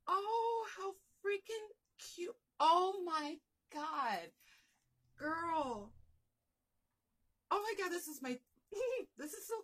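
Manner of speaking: very high, loud squeal with a teary break; overjoyed, almost crying; tearful excitement. Real, not acted. Overwhelmed by cute gift, near tears.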